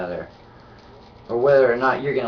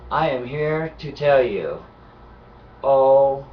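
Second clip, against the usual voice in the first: ordinary delivery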